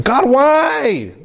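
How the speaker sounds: high, drawn-out cry to God; hammed-up prayerful wail; anguished plea. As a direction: plead upward in mock desperation. Acts his struggle to pray.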